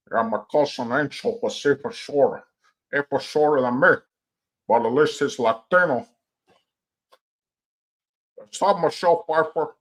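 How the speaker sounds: bad, hammed-up Cuban accent; over-the-top Cuban crime boss accent; Cuban gangster accent. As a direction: argue back as a mock Cuban gangster. Tony argues back in the skit.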